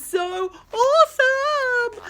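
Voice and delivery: high, loud, drawn out; gushing awesome. Real, not acted. Showing the cover art to kids, high loud drawn-out gush. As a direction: savour the word.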